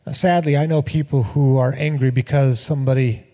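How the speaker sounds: ordinary talk, nothing marked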